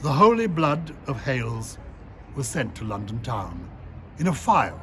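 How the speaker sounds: measured, deliberate poetry recital; steady recital with rising emphasis on key lines; poem reading. Acted. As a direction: read it like a poem with weight. Reads his poem aloud.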